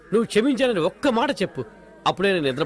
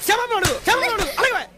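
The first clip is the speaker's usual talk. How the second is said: high, pressing order to apologise; father pushing his daughter to say sorry; insistent demand; 10 semitones higher than usual. Acted. (పట్టుబట్టి గట్టిగా ఆజ్ఞ)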